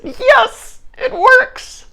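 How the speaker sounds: very high squeal of glee; giddy delight. Acted. King finding the magic lamp works, gleeful squeal. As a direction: squeal it, voice shooting way up.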